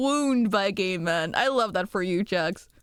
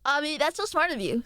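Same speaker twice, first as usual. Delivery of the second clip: usual speaking voice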